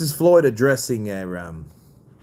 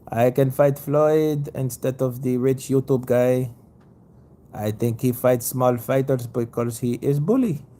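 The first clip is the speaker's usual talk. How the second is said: Manny Pacquiao impression. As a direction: play the aggrieved fighter calling Floyd a bully. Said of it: "Oh, you mean Manny Pacquiao?".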